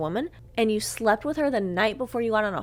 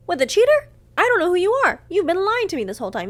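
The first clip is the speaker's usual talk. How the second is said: high, loud, incredulous outburst; indignant raised voice; outraged retort; 9 semitones higher, 8 dB louder, faster than usual. Retold or imitated. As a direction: throw it back at the cheater, loud and high. Retold breakup argument, outraged retort.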